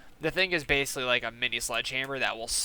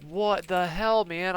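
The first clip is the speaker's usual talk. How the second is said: higher, drawn-out disbelief; mild retold dismay; 6 semitones higher, slower than usual. Bystander to beaten old man, mild retold dismay. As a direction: ask it higher, as if appalled for him. Retelling his shock to crying victim.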